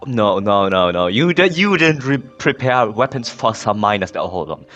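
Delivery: ordinary talk, nothing marked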